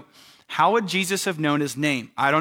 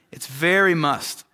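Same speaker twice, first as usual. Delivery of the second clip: ordinary talk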